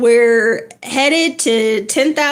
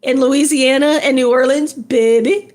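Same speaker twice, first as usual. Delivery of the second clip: playful Louisiana accent, teasing a viewer; a hint of Louisiana accent, greeting a viewer from Louisiana. Shown as a demonstration. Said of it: "That's my Louisiana accent".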